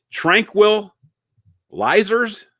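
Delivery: "tranquilizers" broken slowly into halves, high and questioning; unsure, stretched "tranquilizers" with a questioning lift; struggling to pronounce. Shown as a demonstration. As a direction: sound out "tranquilizers" very slowly, tran-quil, pause, lizers. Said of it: "How do you".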